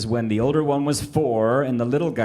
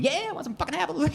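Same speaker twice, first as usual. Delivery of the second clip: put-on fast, much higher little-kid squeak, a bit softer; baby brother's voice; 5 dB quieter, faster than usual. Dad voicing his one-year-old son's eager face, high fast squeak. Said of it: "his face was just screaming".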